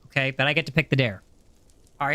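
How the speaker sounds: ordinary delivery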